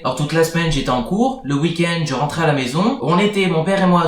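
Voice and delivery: normal talking voice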